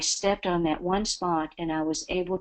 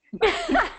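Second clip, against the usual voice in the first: laugh. Real, not acted. Laughs at her own understatement.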